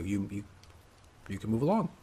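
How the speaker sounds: usual speaking voice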